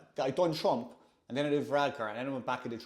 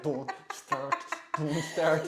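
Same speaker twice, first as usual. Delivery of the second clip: imitated kids' warning; much slower than usual. Kids kicking under the table, mock warning. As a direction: mimic embarrassed children heading off laughter.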